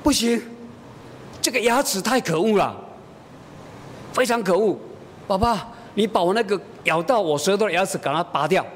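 a small daughter indignant at her tooth; mock-angry kid demanding it be pulled; upset little girl. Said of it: "那他说".

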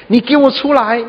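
high, mock angry yell; boy yells at the unseen echo; angry child. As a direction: demand he come out, fuming. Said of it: "啊，他就很生气".

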